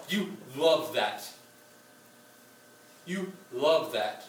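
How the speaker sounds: "You love that" drawn out slowly, leaning on love; slow stress on love. Retold or imitated. Preacher voicing Satan to the congregation, slow and knowing. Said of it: "So he says".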